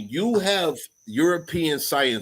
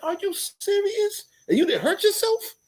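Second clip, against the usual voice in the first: astonished. Retold or imitated. To a frog that jumped off a wall, high astonished disbelief. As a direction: gape at the frog in wonder, voice way up. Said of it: "I'm like".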